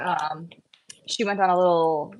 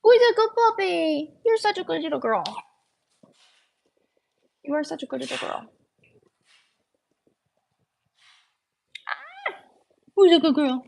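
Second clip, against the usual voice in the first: high cooing praise voice; talking to a dog; 9 semitones higher than usual. Real, not acted. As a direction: coo praise at the puppy. Praising puppy in baby talk.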